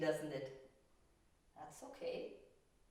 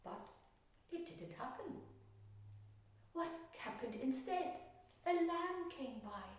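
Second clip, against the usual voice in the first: higher, gentle storytelling voice; story narration; 8 semitones higher than usual. A narrator's reading. Painter telling a children's story while painting. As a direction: tell it like a bedtime story for children.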